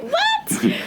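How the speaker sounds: very high laughing squeal of disbelief; shocked what. Retold or imitated. Hearing the old lady's threat, high laughing shocked squeal. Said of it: "I'm like".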